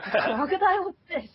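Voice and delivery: high, baffled squeak; can't believe what you're seeing; young fan meeting Team Chaotix, high and baffled; baffled surprise. Retold or imitated. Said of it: "I was like".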